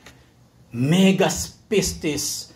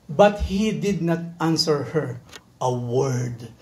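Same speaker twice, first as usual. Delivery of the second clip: slow reading; 4 semitones lower, much slower than usual. Acted. Reading Jesus's silence slowly.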